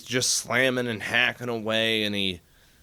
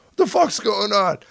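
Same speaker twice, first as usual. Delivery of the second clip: mock yell; 9 semitones higher, 6 dB louder than usual. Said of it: "Staff sergeant immediately is like".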